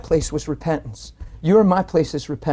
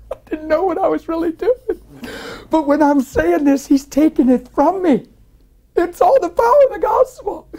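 high, strained and building louder; impassioned, tearful testimony; 10 semitones higher, 6 dB louder than usual. Real, not acted. Preacher telling how his sins were taken, tearfully crying out. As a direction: cry it out with the voice climbing.

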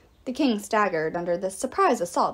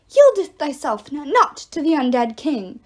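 loud archaic exhortation, pitched up; talking arrow; 4 semitones higher, 8 dB louder than usual. A narrator's reading. Arrow rallying Apollo against the undead king, loud exhortation. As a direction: command like a grand herald. Arrow urges hero not to yield.

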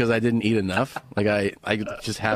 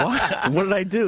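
higher, laughing what did I do; surprised innocence; 8 semitones higher than usual. Retold or imitated. Laughing friend protests to annoyed traveller, mock surprised. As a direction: play it falsely innocent, laughing. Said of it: "I go".

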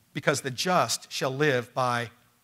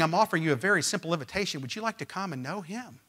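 ordinary talk

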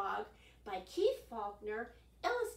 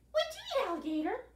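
frog voice; 13 semitones higher, 6 dB louder, faster than usual. Acted. Frog asks alligator.

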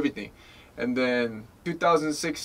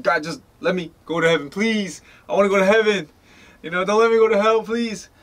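pleading; 6 semitones higher, 4 dB louder, faster than usual. Retold or imitated. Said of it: "I was just like".

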